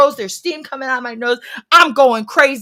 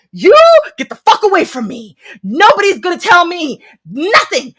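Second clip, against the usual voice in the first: furious threats; 8 semitones higher, 8 dB louder than usual. Retold or imitated. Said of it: "I was like".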